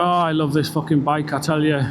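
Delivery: normal talking voice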